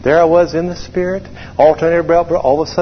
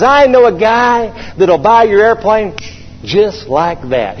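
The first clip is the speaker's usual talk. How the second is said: high, drawn-out boast, dropping to a slow finish; in-your-face; 7 semitones higher, slower than usual. Retold or imitated. Driver offers buyer to upset pilot, mock-cocky. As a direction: imitate a know-it-all, each word stretched. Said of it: "He got right in my face".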